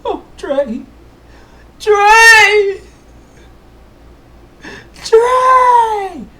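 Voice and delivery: high, loud wailing shout; crying shout. Acted. Game master's king wailing for Trey, high and loud. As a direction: wail his name in despair. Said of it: "The king cries".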